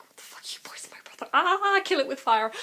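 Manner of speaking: fast, hissed horror; rapid whispered mock outrage. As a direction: whisper it in disgusted panic. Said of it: "'cause, you know, like".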